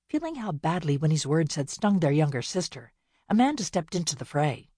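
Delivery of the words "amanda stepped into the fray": usual speaking voice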